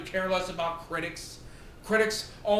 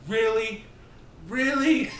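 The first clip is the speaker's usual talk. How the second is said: mock incredulous; 4 semitones higher, 3 dB louder than usual. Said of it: "I was like".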